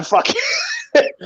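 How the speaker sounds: mock exasperated Alfie, pitched far up and squeaky; imitating Alfie. Fan mimics Alfie watching the keeper, squeaky mock exasperation. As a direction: squeak it out like a fed-up mate. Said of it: "Alfie's sitting there going".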